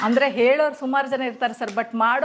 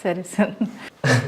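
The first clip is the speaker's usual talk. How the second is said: teary; 6 semitones lower, much slower than usual. Real, not acted. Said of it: "ಓ, ಇವ್ರು ಕಣ್ ತುಂಬ್ಕೊಬಿಟ್ಟಿದ್ದಾರೆ ಇವ್ರು".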